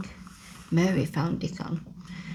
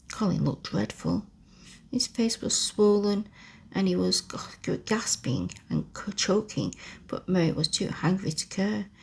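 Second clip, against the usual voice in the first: plain reading voice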